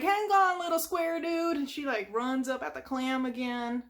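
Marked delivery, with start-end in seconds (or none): 0.0-1.6 a hint of a plucky squirrel rushing in; Sandy voice. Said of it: "she's like".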